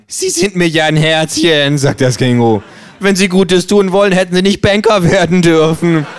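höher, fordernde Känguru-Stimme; freches Känguru. Acted. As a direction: spiel das Känguru dreist und provokant. Känguru spricht. (höhere, dreist fordernde Känguru-Stimme)

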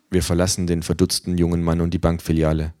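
ordinary delivery

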